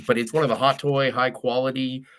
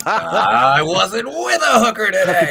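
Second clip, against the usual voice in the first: high, loud, drawn-out yell; hammed-up high holler; movie-quote shout; 9 semitones higher, 9 dB louder than usual. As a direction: belt out the movie line, slowly stretched. Quoting a film line, others laughing.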